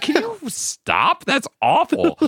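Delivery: very high, slow, pleading squeak; imitating Yellow Guy. Yellow Guy begs the scientists to stop, very high squeak. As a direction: beg them to stop in a tiny high voice. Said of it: "And he's like".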